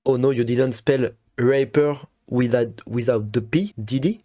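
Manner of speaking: put-on slow lyric quote in a French-accented English; a light English accent on the quoted line; quoting English lyrics. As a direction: recite the rap line slowly, in English. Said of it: "il dit".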